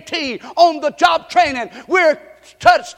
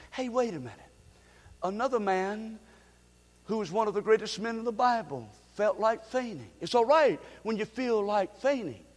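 much softer narration; hushed sermon; 2 semitones lower, 11 dB quieter than usual. Acted. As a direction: draw them in quietly. Hushed storytelling.

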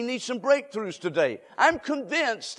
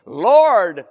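cry out; 10 dB louder than usual. Acted. Preacher crying out to God.

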